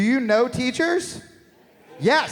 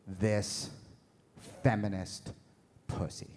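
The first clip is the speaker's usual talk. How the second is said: sultry text; 12 semitones lower, 6 dB quieter than usual. Retold or imitated. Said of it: "she goes".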